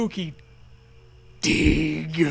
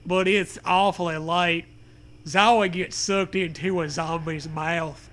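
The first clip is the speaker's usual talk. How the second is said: usual speaking voice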